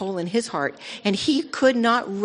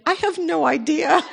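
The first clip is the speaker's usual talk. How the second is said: laughing reply; 10 semitones higher, 5 dB louder than usual. Retold or imitated. Retold reply to surfer, laughing.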